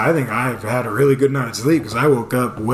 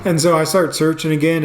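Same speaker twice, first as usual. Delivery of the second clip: ordinary talk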